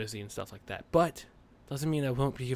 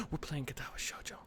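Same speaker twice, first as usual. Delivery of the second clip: breathy unvoiced aside; whisper; 2 semitones higher, 10 dB quieter than usual. Real, not acted. To viewers, after the mock warrior speech, whispering. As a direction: drop to a sudden whisper.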